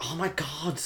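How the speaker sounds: rising excited gasp, pitch up high; breathless countdown awe; hushed then rushing anticipation; awed characters. Retold or imitated. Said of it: "all the characters are like".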